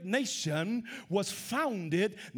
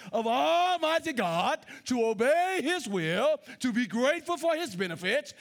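raised, ringing preacher's voice reading a quote; preaching at full force; 6 semitones higher, 3 dB louder than usual. Acted. Preacher rousing the congregation with Washington's words, loud and high. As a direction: build it up like a revival sermon.

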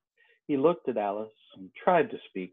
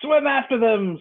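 high, louder cry; storyteller's scream; 12 semitones higher, 5 dB louder than usual. A narrator's reading. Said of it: "screamed the Griffin".

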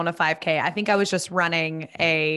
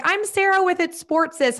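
chipper introduction; 11 semitones higher than usual. Retold or imitated. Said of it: "I was like".

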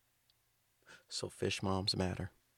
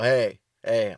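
mock knock-knock hey; 2 semitones higher, 11 dB louder than usual. Greg banging on the door.

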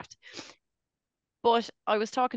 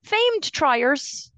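much higher and louder, laughing protest; excited outburst; 6 dB louder, slower than usual. Real, not acted. To Wayne, loudly insisting they really are triers, excited. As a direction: burst out defending the famed triers. Mock outrage about Irish triers.

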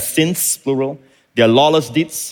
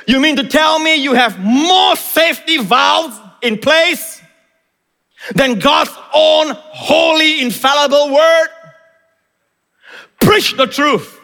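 high, drawn-out preaching shout; impassioned rebuke; 13 semitones higher, 3 dB louder, slower than usual. Real, not acted. Preacher answers critics of God's word, full-force indignant shout. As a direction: thunder back at the doubters with stretched words.

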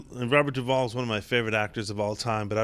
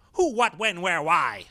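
talking crow, clear; 4 semitones higher than usual. Acted. Crow startles departing onlookers, talking clearly. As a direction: crow suddenly speaking clearly. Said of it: "It's clear as a bell".

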